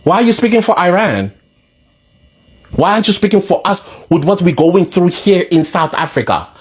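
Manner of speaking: indignant South Africans. Retold or imitated.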